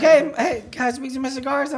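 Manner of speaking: very high, chirpy mock phone voice; bubbly mock hello; happy caller. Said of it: "But she sounds happy".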